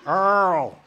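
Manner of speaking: loud, drawn-out Earl, belted out proud; booming name. Shown as a demonstration. Correcting the questioner's name, a loud proud Earl. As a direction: boom out Earl like a big-voiced country man. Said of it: "You gotta talk like, like this".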